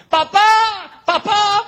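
put-on high, loud child's desperate cry. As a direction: call out to papa from the altar, desperate. Isaac calls from the altar.

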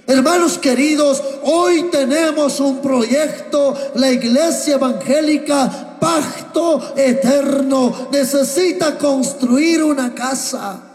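solemn preacher's call; preaching proclamation. Acted. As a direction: announce the project to the brothers, slowly. Pastor proclaims the project.

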